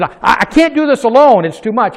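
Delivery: high, loud cry of overload; pleading it's too much; overwhelmed plea. Retold or imitated. Moses to God, loud, high, overwhelmed plea.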